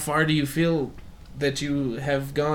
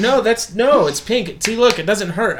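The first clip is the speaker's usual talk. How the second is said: higher, louder, insistent denial; mock insistent protest; 6 semitones higher, 5 dB louder than usual. Pale speaker argues with doubters about sunburn, insistent.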